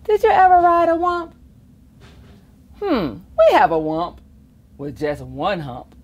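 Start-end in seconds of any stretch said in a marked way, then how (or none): none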